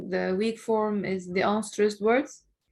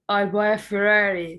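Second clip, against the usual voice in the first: Ferrari lifted and pushed louder; a rising pitch on Ferrari; rising tone demo; 5 dB louder than usual. Shown as a demonstration. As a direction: lean up into Ferrari. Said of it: "so it will be raising".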